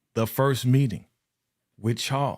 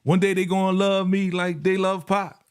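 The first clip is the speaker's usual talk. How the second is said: higher rapper-voiced quote; rapper quote, raised; 9 semitones higher, 3 dB louder than usual. Host quizzing fans on who said it, rapper-style. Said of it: "Who said that line?".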